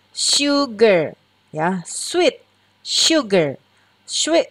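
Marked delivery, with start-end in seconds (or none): none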